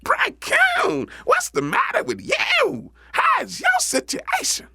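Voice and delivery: very high-pitched rabbit voice; high, animated storybook rabbit; lively Brer Rabbit. Acted. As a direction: call out to a friend, way up high. Rabbit hails his sad friend.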